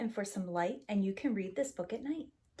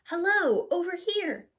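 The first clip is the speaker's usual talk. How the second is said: higher, louder hello over here; calling out; 9 semitones higher, 5 dB louder than usual. Acted. Grandma spots them at the gate, calling out. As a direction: call across the gate to be found.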